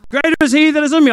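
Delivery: quick, loud, high churchy quote; preachy verse. Retold or imitated. Mocking church verses he refused to use, loud. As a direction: rattle it off like a revival shout. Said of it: "I didn't do that works in church".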